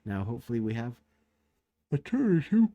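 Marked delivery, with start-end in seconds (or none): 1.9-2.7 words pulled up high by a real yawn. Real, not acted. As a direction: say it mid-yawn, late at night. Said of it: "excuse me".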